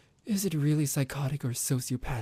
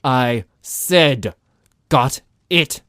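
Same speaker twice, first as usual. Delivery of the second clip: furious shout; 3 semitones higher, 12 dB louder, much slower than usual. Acted. Vampire forcing the captive to answer, furious shout. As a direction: roar the demand.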